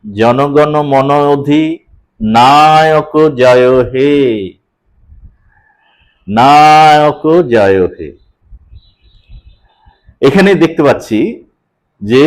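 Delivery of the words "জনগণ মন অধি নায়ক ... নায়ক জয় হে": slow, syllables stretched in places; slow metrical reading of the anthem; drawn-out recitation. Shown as a demonstration. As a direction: stretch the vowels to show the meter. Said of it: "আমি টেনে বলছি".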